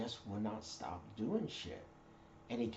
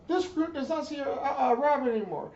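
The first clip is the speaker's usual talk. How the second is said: high, loud recruit reply; recruit shouting back; 8 dB louder than usual. Retold or imitated. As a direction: sound off like a scared recruit. Recruit answering.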